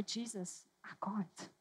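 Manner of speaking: hushed, whispered plea to Jesus; a broken, soft cry for help; whispering prayer of pain; desperate plea. Pretend. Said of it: "you'll say".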